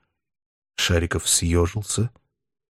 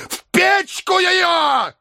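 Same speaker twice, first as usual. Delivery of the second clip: very high slow cry; shouting; much slower than usual. A narrator's reading. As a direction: fling the order out. Professor orders book burned.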